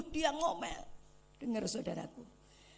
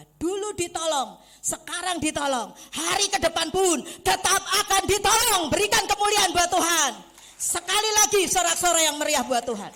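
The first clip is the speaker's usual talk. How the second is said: full-force preaching; 6 semitones higher, 16 dB louder than usual. Real, not acted. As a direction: rouse the whole church to praise. Preacher rouses the congregation.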